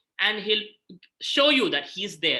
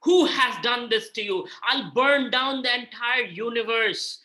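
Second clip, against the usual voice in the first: slow, raised roar of outrage; slow, higher-pitched vow of vengeance; angry Balaram; 4 semitones higher, 4 dB louder, slower than usual. Acted. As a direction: thunder out the threat, drawing the words out. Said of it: "Balaram gets very angry".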